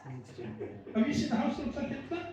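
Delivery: ordinary delivery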